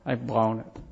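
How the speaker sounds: husband apologizing to his wife, softly and tearfully; tearful apology. Retold or imitated. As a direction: say it softly as if crying. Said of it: "and he cried".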